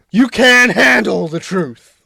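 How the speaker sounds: loud, slow, high movie outburst; mock film shout. Answering "Is that the truth?", mock dramatic shout. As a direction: bellow it like a courtroom showdown.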